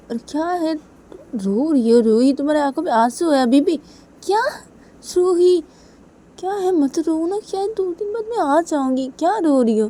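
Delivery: high, loud, upset questioning; worried, voice pushed way up; distressed pleading. Acted. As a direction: plead with her to stop crying. Piyu distressed at Ruhi's tears.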